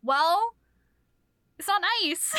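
high pouty sulk; mock pouting complaint; pouting whine. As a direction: sulk like a hurt child. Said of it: "And he's like".

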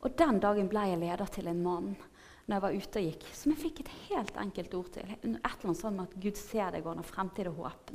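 usual speaking voice

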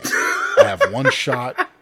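breaks into laughter mid-quote, high and loud; reading cracks up on the word shot; laughing while reading. Real, not acted. Host reading a news quote breaks into laughter. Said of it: "put the emphasis on the wrong word".

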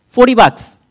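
Forty bucks shouted high and loud; forty bucks thrown at the crowd; shouted emphasis. Acted. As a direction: hit "Forty" hard and high, like the punchline of the sermon. Preaching climax repeating the sum.

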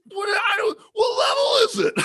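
very high, flustered mock-Scottish wail; hammed-up Scottish confusion; bad Scottish accent. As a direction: squeal it as a panicking Scotsman. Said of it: "my Scottish accent".

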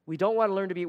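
ordinary talk, nothing marked

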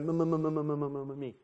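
long droning stammer on M, fading; stuck on the first sound of "me"; stuttering. Acted. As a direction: stutter "m-m-m" on one note until "me". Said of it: "Stutter Smurf is just like".

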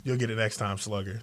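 low, soft pat on the back; mock consoling. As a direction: gently console them, voice dropped low. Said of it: "look at them two B Js and be like".

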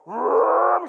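loud revving vroom; high, rolling car-engine roar; engine noise. Retold or imitated.

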